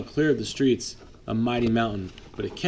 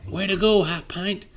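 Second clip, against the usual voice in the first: higher, bright praise; cheering praise; 8 semitones higher, 3 dB louder than usual. Acted. Will praising the little snowplow, higher and cheering. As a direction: cheer him on. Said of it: "Will said".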